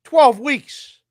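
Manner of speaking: high, loud firm announcement; stern doctor. Retold or imitated. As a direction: lay down the rule firmly. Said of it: "She's like".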